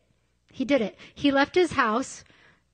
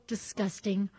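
ordinary talk, nothing marked

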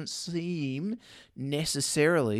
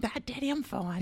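put-on much higher, like a brushed-off little kid; child voice; 10 semitones higher, 4 dB quieter than usual. Vet voicing son refusing night wake-ups, high child voice. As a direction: chirp it as the son waving dad away. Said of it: "See how he goes with that one".

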